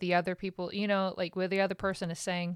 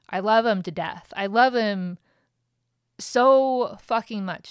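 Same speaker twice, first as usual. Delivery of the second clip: louder, pressing hard on love; emphatic declaration; 2 semitones higher, 8 dB louder than usual. Real, not acted. Telling viewers about Ryan, loud and emphatic. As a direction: swell up with real feeling.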